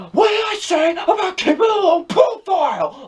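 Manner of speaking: high, full-voiced mock villain shout; wildly overacted villain impression; overacting villain. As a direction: bellow it like a hammy movie villain. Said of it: "his overacting".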